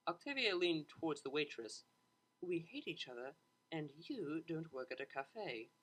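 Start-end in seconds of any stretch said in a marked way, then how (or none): 2.4-5.7 persuasive murmur. A narrator's reading. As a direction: lean in and talk her round. Octavia leaning to persuade.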